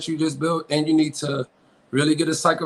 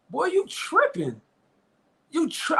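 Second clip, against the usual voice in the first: mock scolding; 11 semitones higher, 3 dB quieter than usual. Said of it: "how would I have responded to that".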